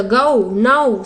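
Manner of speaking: गो and नाउ drawn out very slowly; slow demo. Shown as a demonstration. Teacher showing students how the glide sounds, slowly. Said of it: "औ, ऊ से मिलकर बना था".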